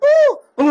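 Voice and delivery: slow mock groans oo, oo; imitating a patient's drawn-out moans; sick man's moaning. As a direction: groan like someone bedridden all night. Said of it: "ఊరికే మూలుగుతా ఉంటాడు".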